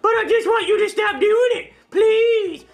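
a hint of a begging dummy; squeaky ventriloquist dummy. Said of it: "my ventriloquist dummy".

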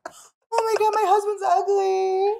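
high, loud, helpless laugh-crying; cracking up so hard it turns to tears; crying with laughter. Real, not acted. Wife sees husband's photo, laughing until she cries. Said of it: "Already feel sad because you're crying".

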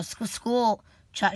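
ordinary delivery, nothing marked

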